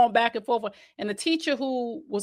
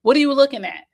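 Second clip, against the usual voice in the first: louder, higher snapping retort; mock confrontation; 5 semitones higher, 8 dB louder, faster than usual. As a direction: confront a staring stranger. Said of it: "we get in our feelings".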